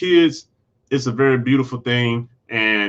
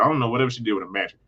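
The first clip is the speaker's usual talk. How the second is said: ordinary talk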